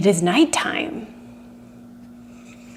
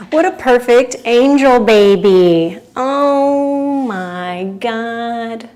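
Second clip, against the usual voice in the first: loud, drawn-out gushing over a pet; adoring gushing; 2 semitones higher, 6 dB louder, slower than usual. Real, not acted. Streamer cooing to her perfect angel baby, adoring gushing. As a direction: gush and stretch the words out adoringly.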